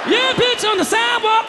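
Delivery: high, shouting impression of the sister; sister driving. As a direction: voice the sister gleefully taking the sidewalk.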